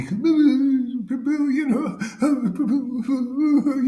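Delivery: high, drawn-out simpering Prabhu; preacher mocking a weak devotee in a high voice; mocking weakling. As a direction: stretch out a feeble plea to the guru. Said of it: "you little weakling".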